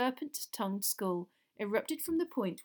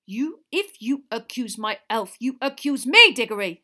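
shouted anger; 4 semitones higher, 13 dB louder than usual. A narrator's reading. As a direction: hammer the accusation back furiously. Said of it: "shouted Mister Crouch".

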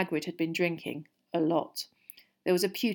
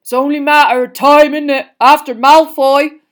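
put-on very loud, higher drunken lament; Hagrid, miserable; 8 semitones higher, 19 dB louder than usual. A narrator's reading. Gamekeeper expects sacking after injury, miserable. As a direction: wail it out in a big voice. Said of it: "said Hagrid miserably".

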